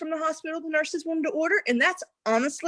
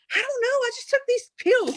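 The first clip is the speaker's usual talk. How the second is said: much higher, lightly innocent voice; innocent naive self; 12 semitones higher than usual. Retold or imitated. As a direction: play dumb, all wide-eyed. Acting her naive younger self.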